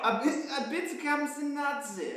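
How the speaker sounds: high-pitched put-on German accent; mock German accent. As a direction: play along in a high, hammed-up German voice. Joining the mock German accent demo.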